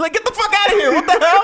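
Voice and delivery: mock disbelief. Friend scoffing at the claimed mixed ancestry, mock outrage. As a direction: scoff loudly at the absurd claim.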